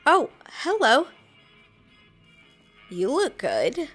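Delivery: ordinary delivery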